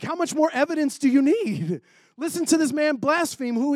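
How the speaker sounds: mock outrage, voicing the high priest in a sermon; outraged high priest. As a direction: toss it off like an offended elder. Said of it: "like, in anger almost".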